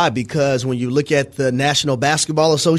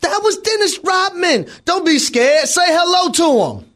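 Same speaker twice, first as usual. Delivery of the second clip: high excited shout; 13 semitones higher than usual. Retold or imitated. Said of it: "the people in the security line".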